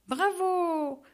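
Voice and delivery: high cheer while clapping; exclaiming bravo. A narrator's reading. Barbie cheering Steve's jump, high exclaim. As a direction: cheer him on. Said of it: "S'exclame Barbie en applaudissant".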